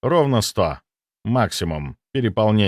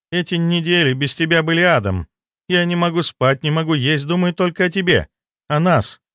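higher, pleading lover's voice; lovesick woman; 7 semitones higher than usual. Acted. Desperate mistress confessing her longing to the doctor, pleading in a higher voice.

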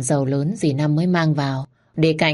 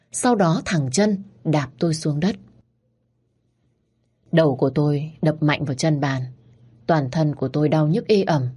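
plain reading voice

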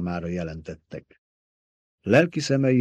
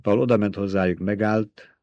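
ordinary delivery

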